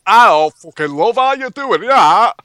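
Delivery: loud, high, slow Northern English accent; drawn-out, exaggerated Northern accent. Shown as a demonstration. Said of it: "If you listen to the Northern".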